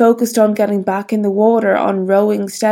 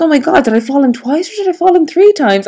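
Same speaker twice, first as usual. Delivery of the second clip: fast, higher flustered inner voice, lightly acted; retold panic; 6 semitones higher, faster than usual. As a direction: rattle it off like you've lost count in a panic.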